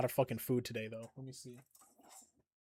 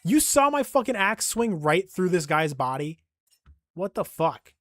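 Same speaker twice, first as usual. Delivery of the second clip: outraged disbelief; 4 semitones higher, 8 dB louder than usual. Real, not acted. Disbelief at missed axe swing.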